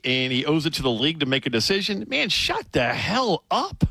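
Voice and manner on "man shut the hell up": high, slow, exasperated; fed-up scoff. Real, not acted. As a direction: shut it down with disgust. Host scoffs at a column.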